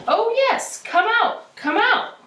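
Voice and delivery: bright-pitched storybook calling voice; faraway voice calling. A narrator's reading. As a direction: call someone outside from a distance. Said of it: "Then she heard the voice say".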